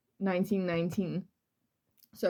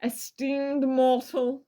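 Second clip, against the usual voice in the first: slow, louder, theatrical villain's opening; dramatic reading; 5 semitones higher, 3 dB louder, much slower than usual. Acted. Host reading the killer's letter aloud, slow and grand. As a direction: declaim it like a demon's letter. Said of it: "This is a dramatic reading".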